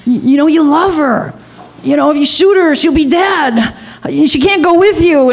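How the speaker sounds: high, insistent retold pleading; urgent reasoning. Teller reasoning with gunman to spare Sue, urgent retold plea. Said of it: "talking logic and reason".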